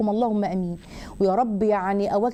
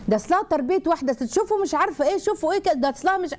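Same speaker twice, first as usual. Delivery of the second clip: high-pitched mock voice of gossiping people; mimicking judgmental gossips; 6 semitones higher than usual. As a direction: imitate society's tut-tutting, pitched well up. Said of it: "بيقولوا كده". (تقليد كلام الناس بصوت عالي الطبقة)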